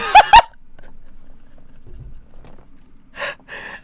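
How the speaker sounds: breathless high-pitched laugh burst; squealing laugh. Real, not acted. Streamer cracks up at a silly viewer comment, high squeal. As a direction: let a shrill laugh escape. Reacting to absurd chat comment.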